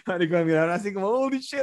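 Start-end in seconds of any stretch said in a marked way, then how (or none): 1.1-1.6 high, shocked gasp of a word; shocked exclamation. Retold or imitated. Kissing couple seeing him vomit, shocked high exclamation. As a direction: mimic a stranger's disgust.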